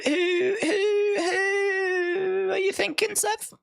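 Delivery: high, slow squeaky questioning voice; mock confused. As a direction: play it like a baffled fan, pitched high.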